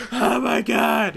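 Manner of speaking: high, slow, drawn-out shout of amazement; fan shout. Retold or imitated. Fans in the cinema losing it at the credit scene, shouting. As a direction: yell it like a stunned fan in the cinema.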